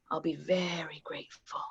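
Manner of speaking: whispered, velvet threat; menacing whisper. Acted. Reggie whispering to Lincoln over the gun. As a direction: hush it like a secret threat.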